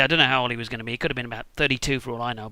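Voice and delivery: ordinary delivery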